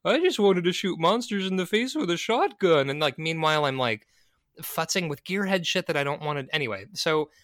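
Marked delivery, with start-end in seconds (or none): 0.0-2.9 Homer Simpson voice. Retold or imitated. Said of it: "I feel like Homer Simpson".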